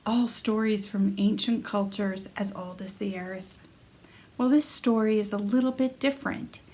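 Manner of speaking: soft announcer intro over music; intro narration. Acted. Narrator introducing the series, soft announcer read.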